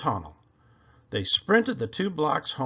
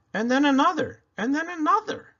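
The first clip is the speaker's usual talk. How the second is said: building excitement; 12 semitones higher, 4 dB louder than usual. A narrator's reading. Building seagull count.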